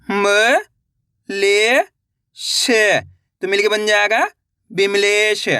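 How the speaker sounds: slow, separate syllables, then the word drawn out; patient teacher dictating syllables; syllable by syllable reading. Shown as a demonstration. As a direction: spell each sound for beginners, then blend.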